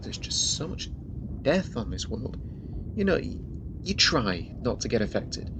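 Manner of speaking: ordinary talk, nothing marked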